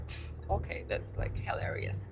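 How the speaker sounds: deadpan hushed sarcasm; whisper. Retold or imitated. Reacting to the boyfriend's watch, sarcastic whisper. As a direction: breathe it out, unimpressed. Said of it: "So I was like".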